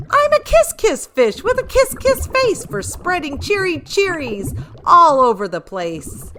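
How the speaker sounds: high, loud and beaming with delight; the fish bursting with new cheer; high gleeful kiss-kiss chant; joyful kiss-kiss refrain. Acted.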